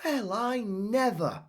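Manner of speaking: high, slow amazed exclamation; exclaimed. Acted. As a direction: say it in disbelief. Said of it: "exclaimed Celia".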